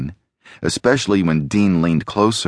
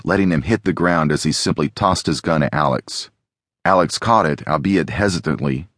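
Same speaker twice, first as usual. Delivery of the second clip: ordinary delivery, nothing marked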